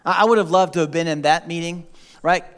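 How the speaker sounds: usual speaking voice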